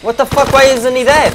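high, loud outraged yell; shouted disbelief. Real, not acted. Gamer yelling at an enemy that won't die, high and loud. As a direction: scream it at the screen in disbelief.

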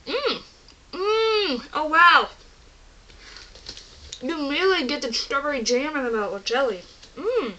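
chewing while talking; mouth full. Real, not acted. Seen 1: Bites a piece before; hand at mouth, holds the piece while talking.

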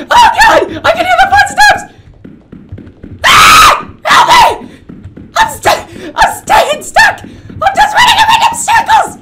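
very high, loud shrieked words; real shrieking panic; panicked screaming. Real, not acted. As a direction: scream it while fleeing a pursuer. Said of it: "I can't stop screaming".

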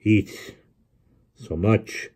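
halting words with long gaps; winded, squeezing out word after word; gasping. A narrator's reading. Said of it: "he gasped".

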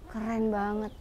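low, soft marvelling; hushed awe. Real, not acted. Host watching a big tiger up close, hushed awe. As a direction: murmur in awe, low and quiet.

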